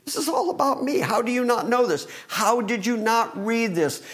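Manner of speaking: high-pitched incredulous questioning, retold; acted-out exasperated rebuke; exasperated chiding. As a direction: chide them with rising disbelief. Jesus quoted chiding his disciples.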